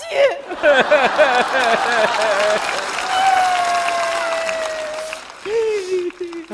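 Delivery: high hooting laugh; burst of laughter. Real, not acted. Father laughs at his daughter's complaint, high hooting laugh. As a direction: laugh out loud, high and long.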